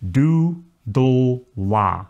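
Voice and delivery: do, dul, la one at a time, stepping down in pitch; syllable demonstration. Shown as a demonstration. Teacher has students say this, separated syllables. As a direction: separate each syllable for repeating. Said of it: "So say this for me".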